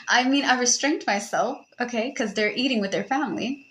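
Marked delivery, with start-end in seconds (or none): none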